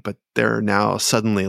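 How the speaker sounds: normal talking voice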